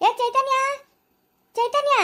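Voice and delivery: calling out. Acted. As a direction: shout the name across to her. Calling the girl from afar.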